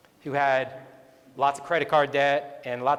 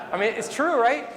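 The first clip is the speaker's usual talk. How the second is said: higher, laughing through the words; 9 semitones higher, 4 dB louder than usual. Real, not acted. Telling the audience it's true while still laughing. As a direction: let the laugh carry the line. Laughing at his own story.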